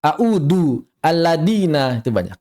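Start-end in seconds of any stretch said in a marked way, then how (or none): 0.0-0.8 "a'udu" with a hard d; mistaken dal. Shown as a demonstration. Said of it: "mengubahnya menjadi dal". 1.0-2.0 slow "alladina" with a plain d; hard d instead of dhal in "alladina"; mistaken dal. Shown as a demonstration. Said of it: "mengubahnya menjadi dal".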